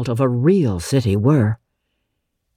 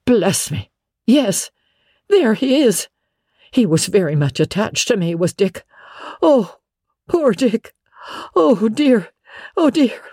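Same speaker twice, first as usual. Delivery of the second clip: high-pitched, moved outbursts; emotional exclaiming; 7 semitones higher than usual. Acted. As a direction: exclaim fondly over a long-lost friend. Scrooge moved at seeing old friend.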